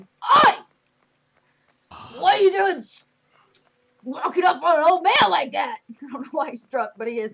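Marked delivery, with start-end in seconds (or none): 0.0-5.8 put-on shouted high, cranky old codger; angry old man. Drunk old cart driver shouting at the orcs. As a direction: yell at them from the cart, indignant. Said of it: "And he goes".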